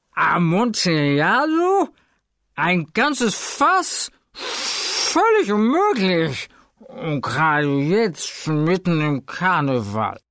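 high, slow, drawn-out cries of astonishment; drunken disbelief. Acted. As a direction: stretch every word in tipsy amazement. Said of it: "rief er". (betrunkener Fortunato, ungläubig und gedehnt)

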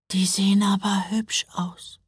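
high, whispery awe; full of admiration. A narrator's reading. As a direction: breathe it out in admiration. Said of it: "sagte Mila voller Bewunderung".

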